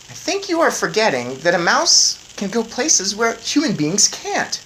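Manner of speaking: put-on boy mouse. A narrator's reading. Boy reminding grandmother, light eager boy voice. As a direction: point it out eagerly.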